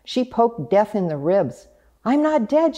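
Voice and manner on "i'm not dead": high, excited duck exclamation rising at the end; quacking duck. A narrator's reading. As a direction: quack it out with delight. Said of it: "she quacked".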